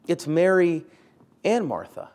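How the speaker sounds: "It's Mary and Martha" with weight on "and"; stress on and. Shown as a demonstration. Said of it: "Mary or Martha".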